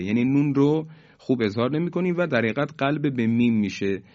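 normal talking voice

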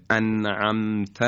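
انعمت drawn out slowly with the nun hummed in the nose; nasal ghunna demo. Shown as a demonstration. Quran teacher demonstrating ghunna to students, slow and nasal. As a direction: hold the nasal hum in انعمت.

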